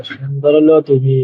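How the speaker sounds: ordinary talk, nothing marked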